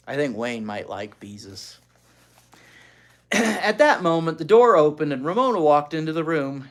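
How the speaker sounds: ordinary delivery, nothing marked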